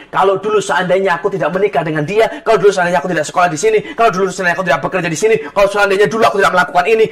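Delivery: fast, run-on string of what-ifs; rapid regretful listing. Retold or imitated. Preacher voices endless regretful thoughts, rapid listing. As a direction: rattle off regrets one after another.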